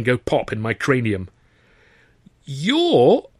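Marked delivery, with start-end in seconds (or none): 2.5-3.3 high-pitched incredulous word; baffled, barely managed. A narrator's reading. Baffled visitor faces the smoking youth, barely managing. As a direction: struggle to get it out, astonished. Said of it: "I managed".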